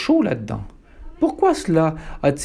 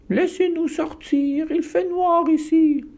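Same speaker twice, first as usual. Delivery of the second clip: whining cub voice, much higher and slow; 13 semitones higher than usual. Acted. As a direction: whimper like a scared little wolf. (pleurnicherie de louveteau, aiguë et lente)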